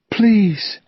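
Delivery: high, pleading plea; a desperate please; pleading cry. A narrator's reading. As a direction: beg him through tears. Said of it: "she cried".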